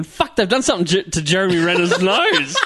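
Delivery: high, rising incredulous outburst with laughter; retold disbelief. Friend retelling his mistaken thought at the film, high laughing disbelief. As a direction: protest it, breaking into laughter. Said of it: "I'm thinking".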